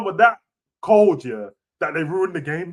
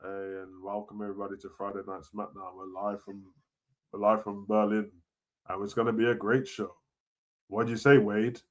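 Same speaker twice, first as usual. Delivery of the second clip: much lower, softer, fast mock intro; lazy mumbled broadcast welcome; sleepy commentator impression; 12 semitones lower, 8 dB quieter than usual. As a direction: drone like a sleepy announcer. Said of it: "exactly how he introduces SmackDown".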